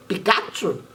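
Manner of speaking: squeaky little creature voice; Pikachu voice. Acted. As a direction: say its own name like Pikachu. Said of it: "said the Pokemon".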